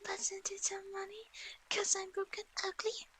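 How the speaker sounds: high unvoiced whisper. Whispered repetition.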